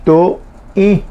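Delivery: ordinary talk, nothing marked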